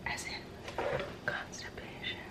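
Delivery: confiding whisper about something embarrassing; hushed, embarrassed aside. Real, not acted. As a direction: drop to a secret whisper. Confiding a TMI detail.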